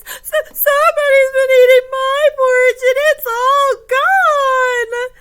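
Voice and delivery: very high, loud, upset little bear; Baby Bear. Acted. Finding his porridge all gone, mock wailing little bear. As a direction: wail it in a hammed-up child voice. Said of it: "He's so upset".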